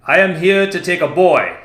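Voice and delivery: loud, high proclamation; loud and clear. Acted. Commander announcing to the village crowd, loud and clear. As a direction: call it out to the whole crowd. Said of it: "he said in a loud and clear voice".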